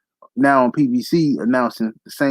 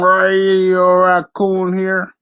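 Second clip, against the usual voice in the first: slow, drawn-out, higher mock broadcaster intro; mocking announcer voice; 5 semitones higher, 4 dB louder, much slower than usual. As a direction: ham up a stiff TV boxing host. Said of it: "Radio Raccoon sound whiter than Joe Biden".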